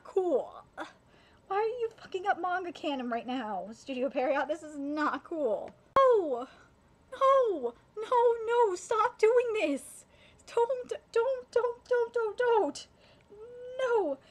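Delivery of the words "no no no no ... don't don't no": higher, louder, frantic repeated cries; desperate pleading yell. Real, not acted. Fan begging the character Gordon, frantic yelling. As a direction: beg the character to stop.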